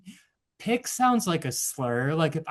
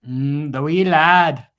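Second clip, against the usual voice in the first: mock Scottish; 8 dB louder, slower than usual. Echoing the Scottish accent.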